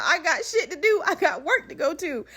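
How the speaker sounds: high, whiny protest with laughter in it; going off. Retold or imitated. Flight attendant protesting she has work, going off. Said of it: "I started going off".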